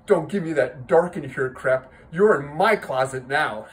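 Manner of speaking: loud, emphatic priest losing patience; irritated rebuke. Acted. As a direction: shut the boy down forcefully. Priest snaps at boy in punchline.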